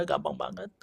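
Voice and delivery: ordinary delivery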